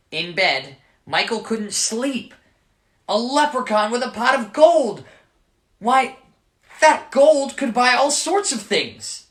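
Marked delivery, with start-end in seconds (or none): 3.1-9.3 excited daydream. A narrator's reading. Michael dreams of gold.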